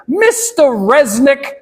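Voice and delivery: put-on high, slow New York boy; New York accent. Boy calling the man at the counter, New York accent. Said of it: "he has a New York accent".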